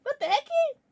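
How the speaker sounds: high squeaky repeat; surprised squeak. Real, not acted. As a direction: squeak it again lighter. Repeats shocked reaction.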